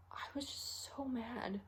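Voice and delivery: quiet, anguished admission; hushed anger. Acted. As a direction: hold the anger in, voice nearly gone. Acted close of grieving, angry monologue.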